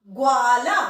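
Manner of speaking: ग्वाला with ग्व joined, ग and व in one syllable; demo pronunciation. Shown as a demonstration. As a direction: say ग्वाला clearly, ग्व fused. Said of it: "तो उच्चारण कैसे हुआ". (ग्वाला का प्रदर्शन उच्चारण)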